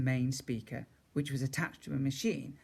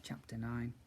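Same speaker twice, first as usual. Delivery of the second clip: ordinary delivery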